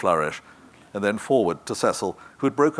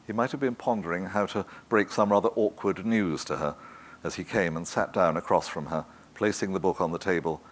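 plain reading voice

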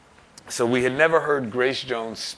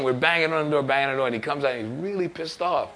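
ordinary talk

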